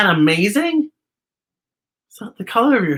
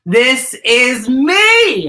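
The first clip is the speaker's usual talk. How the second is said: loud, slow, drawn-out proud yell; a slow, defiant declaration; 6 semitones higher, 5 dB louder, much slower than usual. Shown as a demonstration. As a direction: stretch it out and declare it. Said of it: "you stand up and you yell".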